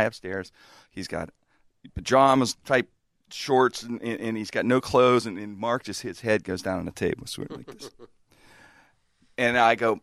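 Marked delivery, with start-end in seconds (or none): none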